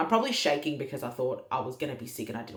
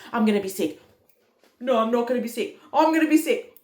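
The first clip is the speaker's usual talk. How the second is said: higher and louder with each phrase, mock panic; hammed-up panic, building; 7 semitones higher, 8 dB louder than usual. Mum retelling her nausea, rising mock panic. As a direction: build to a high, loud pretend wail.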